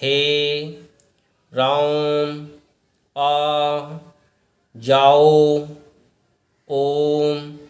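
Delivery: हे राम, आ जाओ said slowly, each vowel stretched three times long; teacher demonstrating the long pluta vowel of a distant call; drawn-out calling vowels. Shown as a demonstration. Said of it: "जैसे".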